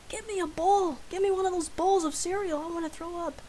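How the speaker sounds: high-pitched imitation of a sick roommate; whiny urgent demand. Roommate about to throw up asks for a bowl, urgently.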